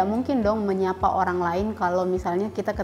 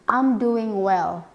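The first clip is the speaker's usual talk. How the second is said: slow model answer; much slower than usual. Shown as a demonstration. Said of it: "bisa seperti ini".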